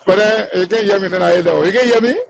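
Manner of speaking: usual speaking voice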